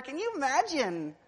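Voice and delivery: loud, high pulpit cry; pounding sermon voice; full-force preaching. Acted. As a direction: drive it home to the congregation.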